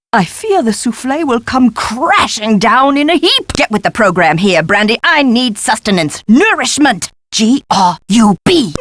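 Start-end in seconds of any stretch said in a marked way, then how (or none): none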